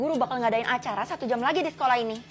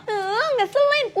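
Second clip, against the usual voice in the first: annoyed whine; 9 semitones higher, much slower than usual. Acted. Annoyed girl complains.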